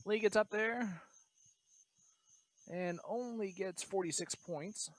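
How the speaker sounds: talking while crunching a biscuit. Real, not acted. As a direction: speak through a crunchy mouthful. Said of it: "Sorry if you can hear me crunching".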